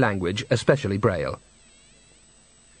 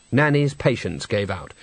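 ordinary delivery, nothing marked

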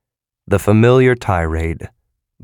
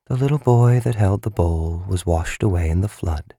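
flat, bored murmur, half to herself; listless, bored. A narrator's reading. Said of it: "in a listless, bored voice".

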